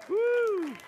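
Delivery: high, loud woo of delight; excited whoop. Real, not acted. Preacher cheers the end of the story with a whoop. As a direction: let out a joyful whoop.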